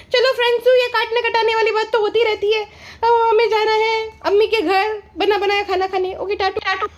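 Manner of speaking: high, put-on sweet vlogger voice held throughout; sarcastic impression of a vlogger answering comments; mock vlogger voice. Vlogger sign-off parody.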